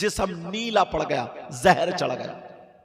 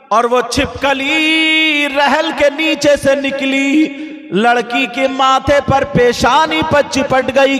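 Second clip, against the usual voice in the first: high, loud sung sermon with music; preaching at full force; 9 semitones higher, 15 dB louder, slower than usual. Acted. Preacher chanting a warning tale to the gathering, loud and sung. Preacher sings story to congregation.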